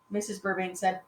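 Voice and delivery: ordinary delivery, nothing marked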